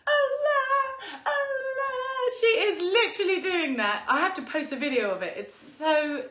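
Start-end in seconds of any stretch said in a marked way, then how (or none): 0.1-2.3 put-on much higher, wailing baby cry on Allah; imitating crying baby. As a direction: wail Allah like a crying newborn. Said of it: "So she's there going".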